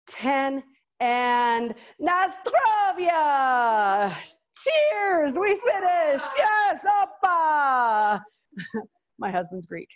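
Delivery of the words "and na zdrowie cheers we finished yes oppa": loud, high celebratory shouts; excited cheering. Real, not acted. Instructor whooping to her class as the set ends, excited shouts. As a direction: cheer like you just crossed the finish line.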